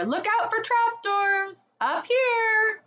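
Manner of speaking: squeaky excited calls; crying out. Acted. Creatures calling warnings during the search, high excited cries. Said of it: "everyone's voices crying out at once".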